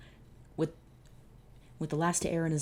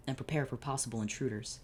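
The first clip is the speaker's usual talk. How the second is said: usual speaking voice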